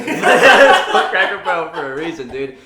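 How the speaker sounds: loud, high, laughing through it; real laughter breaking into the words. Real, not acted. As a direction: crack up while repeating the joke. Laughs while repeating own joke.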